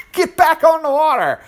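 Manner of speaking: laughing, voice jumps up high. Real, not acted. Laughing, pitch leaps up.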